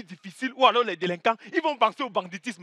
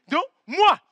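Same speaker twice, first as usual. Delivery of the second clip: ordinary delivery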